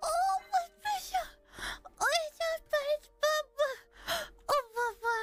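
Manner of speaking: cute gushing. Real, not acted. Gushing over something cute.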